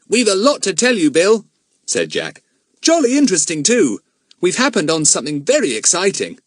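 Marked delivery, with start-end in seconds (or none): 0.1-1.5 put-on high, loud boyish excitement; eager schoolboy. Jack bursting with news. 2.8-6.4 put-on high, louder boyish enthusiasm; eager schoolboy. Jack bursting with news.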